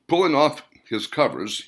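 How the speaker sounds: usual speaking voice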